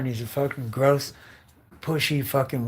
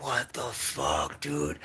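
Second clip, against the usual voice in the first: slow, soft, dazed mumble; like just coming round from drugs; groggy waking up; 3 semitones lower, 7 dB quieter, much slower than usual. Retold or imitated. Imagined victim waking up drugged, dazed and slow. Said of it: "and you're like".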